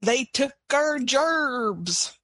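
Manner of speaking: high, drawn-out mock complaint; mock anti-immigrant yokel. Podcast co-host mocking rhetoric about immigrants taking over, mock yokel whine. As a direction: stretch it out as a caricatured whiner.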